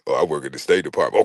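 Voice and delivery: low, quick show-off line; lightly voiced bragging man. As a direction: drop the job title to impress her.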